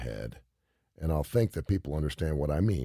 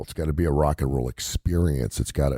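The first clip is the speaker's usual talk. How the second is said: usual speaking voice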